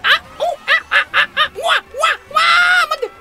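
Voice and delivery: very high, loud cartoon yelps; mystery cartoon voice squeals; unclear impression. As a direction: yelp like some game character. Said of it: "今何のモノマネしたのかわからない".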